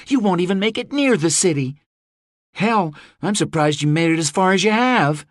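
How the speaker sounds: a lightly voiced daughter, anxious; Rose. A narrator's reading. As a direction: Rose telling her father the situation is grim.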